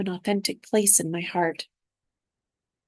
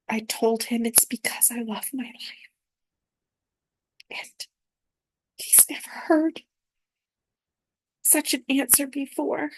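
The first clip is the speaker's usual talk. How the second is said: soft, breathless whisper rising higher; tearful whisper; 3 semitones higher, 4 dB quieter than usual. Real, not acted. Telling the Purple King she loves her life, whispered through tears. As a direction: barely voice it, moved to tears.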